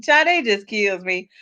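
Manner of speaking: ordinary talk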